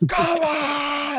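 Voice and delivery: high, loud full-throated yell; screaming yell. Retold or imitated. Dad yelling at a televised fight, screaming. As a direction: holler it at the fight on TV. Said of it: "screaming my lungs out".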